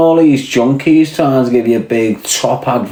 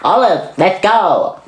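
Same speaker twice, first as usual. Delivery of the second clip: high, swooping cartoon sailor call; Popeye; 9 semitones higher, slower than usual. Popeye calling to Olive, high cartoon sailor voice. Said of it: "Fucking Popeye just came out of me".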